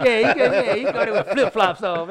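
loud, high disbelief; incredulous. Real, not acted. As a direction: blurt it out amazed. Incredulous about flip-flops.

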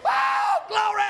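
high, loud glory shout. Real, not acted. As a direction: burst out in praise. Shouting praise while laughing.

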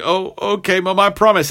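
put-on high, meek young voice, giving in; meek son. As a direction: promise Mom in a small high voice. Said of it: "And he's like".

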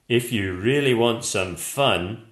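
a hurt monster lecturing kindly; gentle monster. A narrator's reading. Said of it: "he said".

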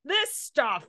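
high, loud mock outrage; indignant daughter. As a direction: imitate an indignant kid protesting to mom. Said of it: "she gets all indignant".

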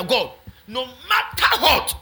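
loud, high, slow cry to God; fervent prayer. Real, not acted. Pastor giving the church one prayer to pray, fervent and loud. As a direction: build to a ringing NEVER, with weighty pauses.

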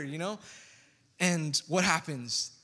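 normal talking voice

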